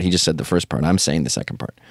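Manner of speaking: ordinary talk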